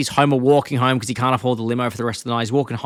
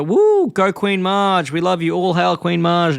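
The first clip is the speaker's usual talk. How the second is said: higher, drawn-out hooray for the queen; mock crowd cheer; 6 semitones higher, 3 dB louder, slower than usual. Retelling the prom crowd hailing the new queen, mock cheer.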